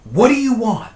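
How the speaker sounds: high, loud aggravated call; retold yell. Aggravated sleeper answering cousin who keeps calling, acted yell. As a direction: yell it, fed up with waiting. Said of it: "I finally yell out again".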